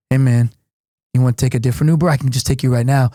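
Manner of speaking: low, hushed hustler pitch; a hint of a shady guy lowering his voice, offering a ride; shady driver. Unlicensed driver tempts travellers, mock low voice. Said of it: "he goes like".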